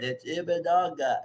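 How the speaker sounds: slow, careful Imanaga, as "ee-ma-NAH-ga"; mock teacher saying Imanaga slowly; imitating a correction. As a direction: spell out Imanaga patiently to a friend. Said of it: "and you're like".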